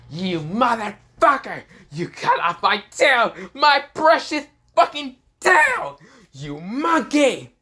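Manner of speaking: high, loud, slow screeching rage; furious villain rant. Acted. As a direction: rage over the lost tail. Frieza raging over lost tail.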